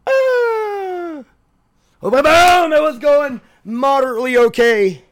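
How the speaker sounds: sudden high shouted outburst; loud yell. Real, not acted. Yelling at dogs crashing in.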